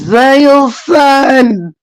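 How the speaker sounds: slow, drawn-out Daniel son; slow drawn call. Real, not acted. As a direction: stretch out Daniel son slowly. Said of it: "Daniel son repeated".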